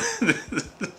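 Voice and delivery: short high laugh that falls away; burst of laughter. Real, not acted. Laughing while recalling the X-Force parachute scene.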